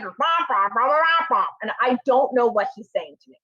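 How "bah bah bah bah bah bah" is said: Charlie Brown adult. Retold or imitated. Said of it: "the Charlie Brown adult in my head".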